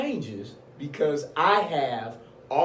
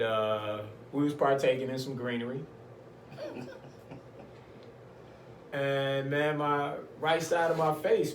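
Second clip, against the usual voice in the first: normal talking voice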